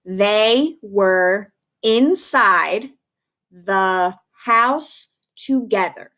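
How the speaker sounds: very slow, careful dictation sentence. Shown as a demonstration. Teacher dictating the sentence slowly to students. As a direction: read each word slowly for writing. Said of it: "what we do in class".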